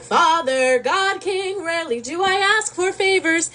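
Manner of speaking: high, exaggerated entreaty to a king; pleading flattery. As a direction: lay on a lofty, high-pitched plea. Athena flatters and pleads with Zeus.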